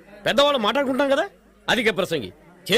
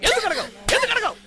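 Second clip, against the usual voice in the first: escalating demand; 9 semitones higher than usual. Acted. Father demanding apology, repeated.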